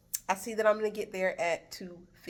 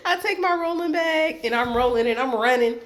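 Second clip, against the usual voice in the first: loud, raised voice breaking out of a laugh; laughing, hurried retelling; 4 semitones higher, 7 dB louder than usual. Retold or imitated. Traveler recounts running with a rolling bag, loudly amused. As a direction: laugh through it at full volume. Re-enacts finally rushing up the ramp.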